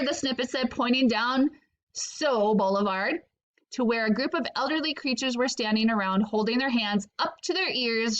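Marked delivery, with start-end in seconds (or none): none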